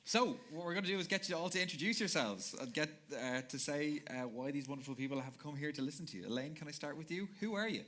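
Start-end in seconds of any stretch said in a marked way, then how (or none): none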